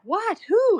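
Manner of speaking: high, startled question; surprised. Retold or imitated. Mom startled when her son shows the cat, high and sharp.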